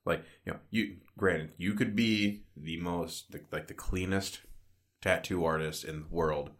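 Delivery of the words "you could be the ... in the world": usual speaking voice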